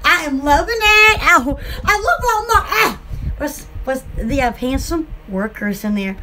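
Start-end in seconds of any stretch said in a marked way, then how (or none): none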